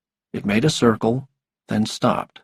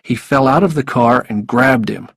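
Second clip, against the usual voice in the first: plain reading voice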